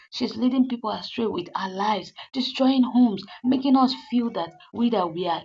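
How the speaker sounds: angry sermon against the wife; heated rant. Real, not acted. Speaker rants at the wife.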